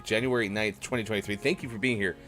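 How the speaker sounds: ordinary talk, nothing marked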